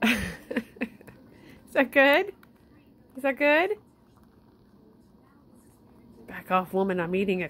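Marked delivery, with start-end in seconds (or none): 0.0-2.3 much higher, louder sing-song. Real, not acted. Owner cooing at the pet about the treat, high. 3.2-3.8 much higher, rising coo. Real, not acted. Owner asking the pet in high baby talk.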